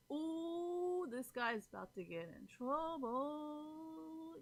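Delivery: high, slow teasing ooh, drawn out; mock ominous aside. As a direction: sing it out slowly like trouble is coming. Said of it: "I'm like".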